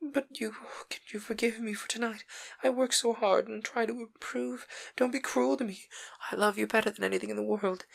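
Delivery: high, hushed, breaking plea near a whisper; Sybil, desperate pleading. A narrator's reading. Sybil begging Dorian at his feet, hushed and desperate. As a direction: beg him not to go, barely holding together.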